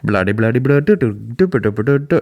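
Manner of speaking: gabbled mock Indian accent, bouncing up and down; bad Indian accent. Imitating the interviewed woman, badly faked Indian accent. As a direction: fake an Indian accent with nonsense filler. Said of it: "I can't do the accent, but she was like".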